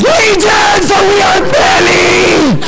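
high, drawn-out crazed shriek; demonic scream, not plain. Acted. Demoniac answering Jesus with his name, crazed scream. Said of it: "No, he doesn't say Legion".

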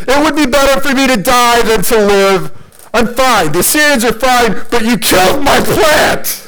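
high whiny protest building to a shout; hammed-up outrage. Jonah railing at God for killing his plant, hammed-up outrage. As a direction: melodramatic tantrum, peaking on the plant. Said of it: "He wanted to die".

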